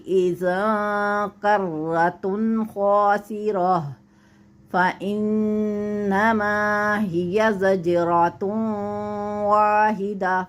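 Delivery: slow, drawn-out Quranic recitation. Shown as a demonstration. Teacher recites the verse slowly for the student. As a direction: chant each syllable long and measured.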